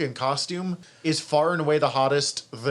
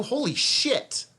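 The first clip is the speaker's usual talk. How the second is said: stunned, high-pitched swear; real astonishment; 9 semitones higher, slower than usual. Real, not acted. Podcast host realizing who the actress is, astonished. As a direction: swear in sudden amazement, pitch leaping up and drawn out.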